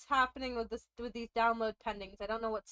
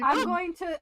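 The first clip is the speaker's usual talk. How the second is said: laughing mock threat; 6 semitones higher, 5 dB louder than usual. Real, not acted. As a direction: say it through a laugh.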